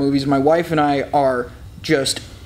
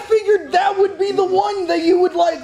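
incredulous shout; 13 semitones higher than usual. Real, not acted. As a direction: shout it in disbelief. Friend disbelieves Guardians of the Galaxy.

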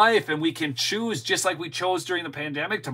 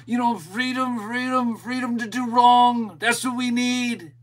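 higher, whiny mock-panic voice, exaggerated; mocking hand-wringing liberal; 4 semitones higher than usual. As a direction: sneer at them by playing them as fretful and melodramatic. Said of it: "the majority of America is just gonna get all".

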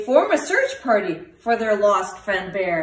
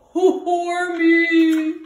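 wailing; 5 semitones higher than usual. A narrator's reading. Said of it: "he wails".

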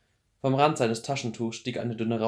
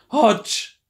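high, loud cry of his name; desperate shout; 12 semitones higher, 11 dB louder than usual. Acted. Clary calls out behind barrier.

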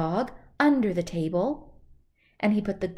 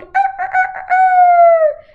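much higher, louder crowing er er er; rooster crowing; 14 dB louder, slower than usual. Acted. Storyteller crowing high and loud as the rooster. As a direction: crow like a rooster on the roof.

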